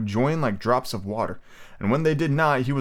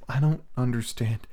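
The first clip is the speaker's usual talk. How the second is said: ordinary delivery, nothing marked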